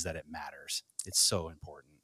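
soft whisper. Real, not acted. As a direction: drop to a breathy whisper. Tender aside after praising couples.

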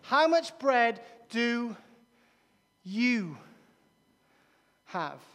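slow, with "you" set apart by long pauses; stress on YOU. Shown as a demonstration. Jesus turning the question on the disciples, stress on "you". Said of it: "Where's the emphasis there? Emphasis on the disciples".

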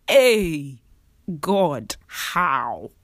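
exaggerated African accent, a loud baffled cry to God. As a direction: playfully do an African accent, asking God how. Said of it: "In my African voice".

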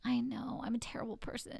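hushed guilty admission; whisper. Real, not acted. Sheepish confession after laughing.

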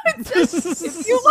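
high, laughing read; words breaking up into laughter; cracking up. Real, not acted. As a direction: try to read through real giggles. Cracks up misreading the script.

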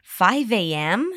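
"Five A M?" with a rising, surprised tune; shocked question. Shown as a demonstration. Teacher models her shocked question for learners. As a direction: ask "Five A M?" in disbelief. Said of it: "focusing on the intonation".

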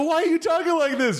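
very high, hammed-up protest; overacted high-pitched questioning; mock indignation. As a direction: confront the dummy in stagey dismay. Acted argument with dummy.

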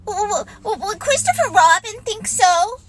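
high, stuttering timid voice; a nervous little pig hesitating; stammering Piglet. Acted. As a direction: stutter out the worried question.

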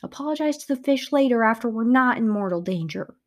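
loud, sharp retort; snapped. Acted. Narrator snapping back at Gigi about the fish. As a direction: cut the complaint off. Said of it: "I snapped".

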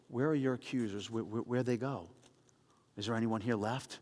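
tender, hushed, reassuring; gentle question. Acted. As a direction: quietly ask where they went. Jesus asks the woman gently.

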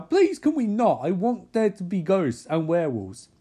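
high, loud whiny protest; hammed-up pleading outburst; mock pleading. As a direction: beg in exaggerated exasperation. Acted protest in recap.

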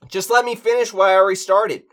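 high, loud and fast; indignant protest. Acted. Man interrupted during his sound check, indignant. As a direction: push back in a rush.

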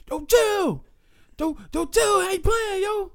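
very high, loud mock yelling; comedian's yelled warning. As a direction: yell it like a stand-up bit. Imitates Kevin Hart's DVD bit.

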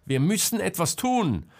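high, loud demand; panicked cry to act; urgent shout. A narrator's reading. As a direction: shout it while shaking him. Selma shakes Marcel in panic.

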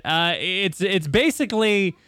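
ordinary talk, nothing marked

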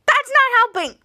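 high, loud mock outburst; play-acted indignant yell; annoyed protest.

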